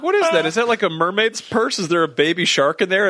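high, puzzled questions; surprised. Retold or imitated. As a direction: wonder what the thing is. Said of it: "we were like".

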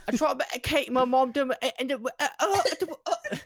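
garbled, high mock Scottish mumble, laughing; imitating the Brave princess, unintelligible and high; hammed-up Scottish gibberish; mock Scottish accent. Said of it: "heavy Scottish accent".

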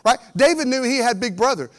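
ordinary talk

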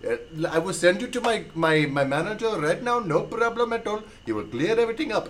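prank-call agent. Retold or imitated. Caller offering mom his manager, put-on agent voice. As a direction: play the unbudging phone agent.

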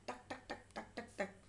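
quick light tapping syllables; poking noises. A narrator's reading. Mother pokes here and there with the broom, poking noises. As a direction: tap out soft staccato pokes.

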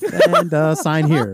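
high, loud mock traffic cop. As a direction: bark it like a bored cop.

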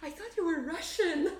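high, loud, laughing disbelief; hammed-up high surprised voice; mock shock. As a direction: mimic a clueless friend's amazement. Mocking shocked acquaintances.

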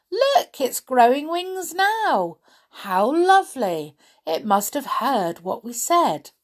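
high, loud delighted exclamation; cried out in delight. A narrator's reading. Molly spots the chair growing wings, crying out in delight. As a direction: squeal it like a thrilled child. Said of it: "Molly cried out in delight".

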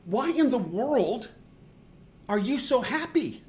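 baffled why-are-you-happy question; incredulous question. Retold or imitated. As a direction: ask as if it makes no sense. Asking the always-happy girl, incredulous.

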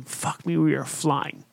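murmured low under the breath; thinking it to himself as the garage flies by; hushed inner thought. Retold or imitated. In the passenger seat, scared of her speed, a hushed inner curse.

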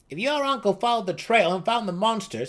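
high, loud lecturing gnome; Seldig the gnome. A narrator's reading. Seldig lectures the boasting gnome, high and loud. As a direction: lay it out like a know-it-all elder.

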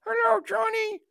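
tiny squeaky high toy voice; cute bear voice. Pretend. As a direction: squeak it like a cuddly teddy. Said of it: "Just a little cute bear".

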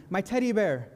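high, mock scream of a child; retold scream. Daughter runs back upstairs for her teddy bear, mock scream. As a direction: cry out for the lost teddy bear.

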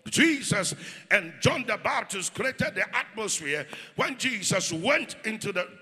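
loud fervent preacher calling out; preaching at full force. Acted. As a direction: preach full-voiced, rousing the church. Calls for praise and declarations.

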